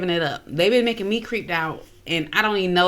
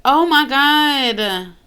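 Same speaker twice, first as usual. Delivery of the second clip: loud, high, very drawn-out shock; shocked exclamation; 5 semitones higher, 6 dB louder, much slower than usual. Real, not acted. Reactor floored by the past-life story, drawn-out shock. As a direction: stretch it right out, stunned and loud.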